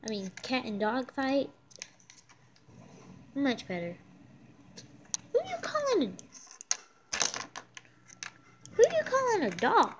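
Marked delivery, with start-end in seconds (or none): 5.3-9.9 indignant. Pretend. Indignant dog retorts to insult.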